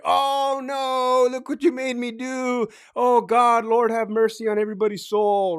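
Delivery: high, hammed-up mock lament; over-the-top remorse. Cop performing remorse after a shooting, exaggerated mock anguish. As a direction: wail regret for show, pitched high. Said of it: "They'll put a ten on it".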